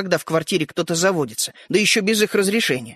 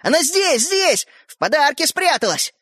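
high, urgent exclaiming; pitched-up boyish excitement; excited cry; 8 semitones higher than usual. A narrator's reading. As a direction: cry out over your catch. Boy shouts over his catch.

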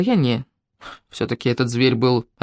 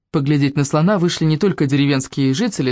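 ordinary delivery, nothing marked

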